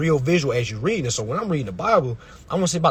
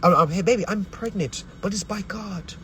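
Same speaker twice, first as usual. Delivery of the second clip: imitated wife; 6 semitones higher than usual. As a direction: coo it high like a wife breaking news. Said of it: "Imagine your woman that you're married to come to you and say".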